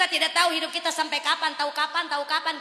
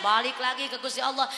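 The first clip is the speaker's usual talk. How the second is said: ordinary delivery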